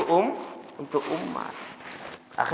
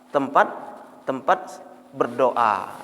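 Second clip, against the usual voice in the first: ordinary talk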